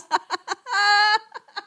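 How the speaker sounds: high, loud tearful laugh; a real, helpless high laugh with tears in it; laughing-crying. Real, not acted. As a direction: laugh and cry at once. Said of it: "I was laughing so hard".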